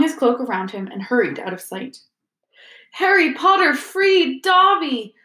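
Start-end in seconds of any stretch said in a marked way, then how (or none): 2.9-5.1 high, loud, slow elf cry; shrill elf. A narrator's reading. Elf gazing up at his rescuer, shrill. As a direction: cry it out shrilly, stretching the words. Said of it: "said the elf shrilly".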